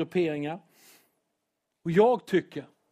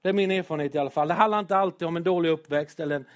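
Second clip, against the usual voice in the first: ordinary talk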